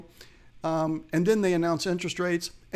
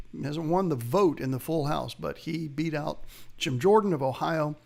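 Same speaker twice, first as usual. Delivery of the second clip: ordinary talk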